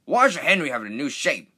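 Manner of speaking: indignant complaint; cross engine. Acted. Big engine complaining to the narrator, cross. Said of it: "Gordon was cross".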